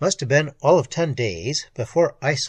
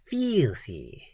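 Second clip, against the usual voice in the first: disgusted foreign mechanic; 4 dB quieter than usual. A narrator's reading.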